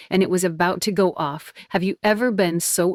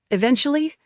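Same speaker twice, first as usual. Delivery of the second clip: snapping; 8 semitones higher, faster than usual. Acted. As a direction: snap the word back in disbelief. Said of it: "I snapped".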